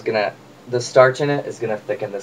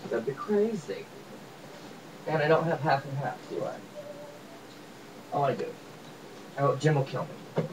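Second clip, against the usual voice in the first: muttered aside; 3 semitones higher, 13 dB quieter than usual. Real, not acted. Muttered asides, much softer.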